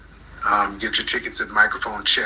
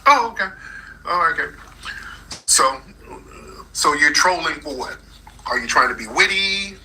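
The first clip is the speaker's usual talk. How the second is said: ordinary talk, nothing marked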